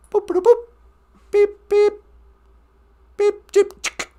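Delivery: high beeping computer noises; computer beeps. Pretend. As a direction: beep like a starship computer.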